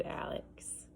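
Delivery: whispered name, tender; soft whisper. Acted. Dad answering his son in a whisper. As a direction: breathe the name gently.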